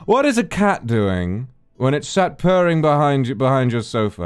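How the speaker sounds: mock posh British comic. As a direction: put on a grand British accent. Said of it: "he's got this really great posh voice".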